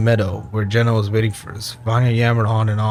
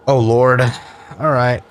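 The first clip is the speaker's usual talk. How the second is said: exasperated sigh; 5 dB louder than usual. Real, not acted. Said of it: "three options here".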